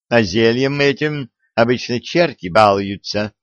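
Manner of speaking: usual speaking voice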